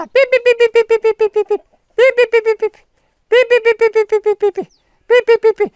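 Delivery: very high, repeated pips; calling hens. Real, not acted. Man calls his six hens home, high piping call.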